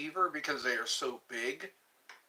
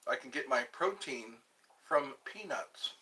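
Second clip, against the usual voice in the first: ordinary talk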